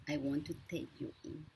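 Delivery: normal talking voice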